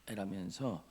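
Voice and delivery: ordinary delivery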